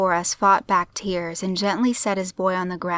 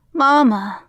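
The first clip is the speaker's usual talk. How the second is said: put-on high, loud little-boy cry; raspy child's shout; 6 semitones higher, 6 dB louder than usual. A narrator's reading. Little boy running to who he thinks is mother, raspy shout. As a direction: call out like an excited small boy. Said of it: "shouted, in a raspy voice".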